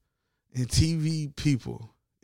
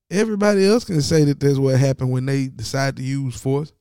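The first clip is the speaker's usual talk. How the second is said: ordinary talk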